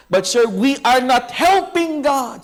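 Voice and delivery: earnest appeal. Retold or imitated. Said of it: "sabi niya".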